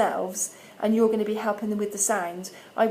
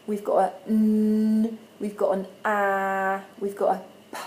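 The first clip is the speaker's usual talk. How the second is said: slow, drawn-out "n" and "ah"; slow sound naming. Shown as a demonstration. Teacher naming sounds for parents, slow careful demo. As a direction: stretch each sound out slowly. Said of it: "look at the first three".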